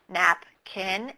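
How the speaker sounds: slow syllable-by-syllable then blended napkin; slow blending demo. Shown as a demonstration. Teacher shows pupils how to blend napkin, slowly. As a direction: stretch it out like sounding it out for a class. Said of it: "Point to each syllable as it's said".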